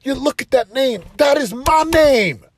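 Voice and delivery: high, loud, pleading to his son; emotional shout to son. Retold or imitated. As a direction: raise the voice high and loud, slowing on the name. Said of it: "I'm like".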